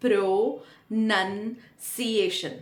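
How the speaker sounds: "Pronunciation" slowly, syllable by syllable; careful pronunciation. Shown as a demonstration. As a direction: break "Pronunciation" into pro-nun-ciation, nun not noun. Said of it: "let's take the word pronunciation". ("Pronunciation" का धीमा, टुकड़ों में उच्चारण)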